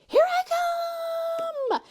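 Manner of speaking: very high, loud gleeful shout; joyful call. Retold or imitated. Daughter launching gleefully across the field, joyful shout. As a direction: sing out with joy, high and loud. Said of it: "laughing with joy".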